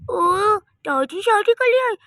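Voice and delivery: put-on high, loud surprised child; astonished. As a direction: blurt out the shocking news. Child hears grandma has married.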